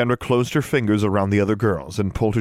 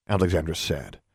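plain reading voice

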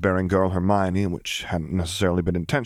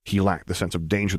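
ordinary delivery

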